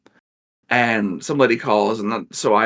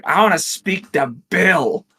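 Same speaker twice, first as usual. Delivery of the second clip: demanding customer; 6 semitones higher than usual. Retold or imitated. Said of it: "she's like".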